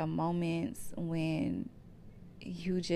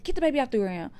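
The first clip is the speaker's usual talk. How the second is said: mom's urgent order; 7 semitones higher, 6 dB louder, much faster than usual. Retold or imitated. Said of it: "my mom was like".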